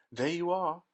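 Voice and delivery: high, loud triumphant call; ringing, triumphant Wonka exclaim; triumphant cry. A narrator's reading. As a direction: announce it like a proud discovery. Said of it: "he cried triumphantly".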